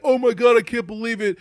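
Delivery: loud, hammed-up amazed customer; mock gullible delight. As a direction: gush in fake amazement, starting high and loud. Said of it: "and be like".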